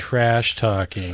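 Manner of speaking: "trash talking" drawn out slowly, as if quoted; air-quote emphasis. Real, not acted. Co-host naming what Ryan does, slow air-quote emphasis. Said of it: "You're doing air quotes every time".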